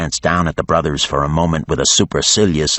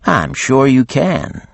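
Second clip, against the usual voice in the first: slow, higher, dry disbelief; dry Geneva; 8 semitones higher, slower than usual. A narrator's reading. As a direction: humour the beast. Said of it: "said Geneva".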